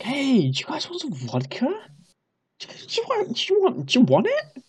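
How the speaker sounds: lightly voiced drunk party host; high drunken offering. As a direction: wave the bottle round, calling out in a high giddy voice. Drunk offering vodka to everyone.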